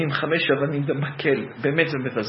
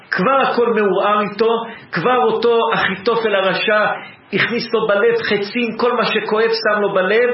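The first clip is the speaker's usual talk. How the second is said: louder, higher preaching intensity; impassioned preaching; 6 semitones higher, 5 dB louder than usual. Acted. Preacher to congregation about Saul, impassioned preaching. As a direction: press the pain of Saul's heart.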